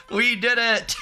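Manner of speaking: high squeal on the edge of laughter; laughing triumph. Real, not acted. Streamer celebrating the silly team name, high laughing cheer. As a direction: celebrate while cracking up.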